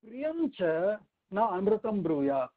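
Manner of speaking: slow, even Sanskrit recitation; measured recitation. Acted. Teacher reciting the shloka for students, measured. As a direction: recite each phrase like a schoolroom chant.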